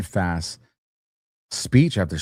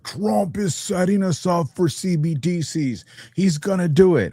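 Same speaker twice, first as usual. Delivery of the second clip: mock critic voice; 8 semitones higher than usual. Mocking critics' claims.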